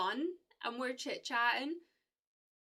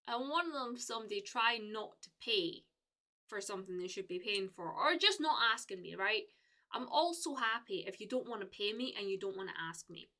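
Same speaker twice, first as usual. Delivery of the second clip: ordinary talk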